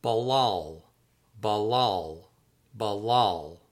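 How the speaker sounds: "Balal" said as bah-LAHL; Hebrew pronunciation. Shown as a demonstration. Author teaching readers a Hebrew word, careful bah-LAHL. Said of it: "that's pronounced".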